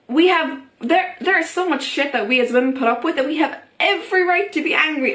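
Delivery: ordinary delivery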